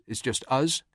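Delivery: ordinary talk, nothing marked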